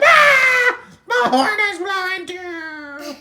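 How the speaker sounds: high squeal, drawn out at the end; excited scream. Acted. Buttercup answering Yodi about horn, high shriek. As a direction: scream back in cartoon alarm.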